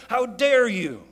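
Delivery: loud, high, hammed-up indignation; acted outrage. Man to a broken tool he just flung, outraged. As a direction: hurl mock fury at the thing. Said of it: "fling it across the yard".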